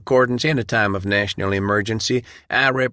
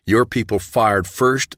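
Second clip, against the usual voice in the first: ordinary delivery, nothing marked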